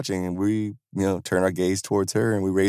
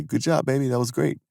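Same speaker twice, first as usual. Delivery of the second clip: put-on higher-pitched praise for a small child; parent's praise; 6 semitones higher than usual. Parent to child who slid down, encouraging praise.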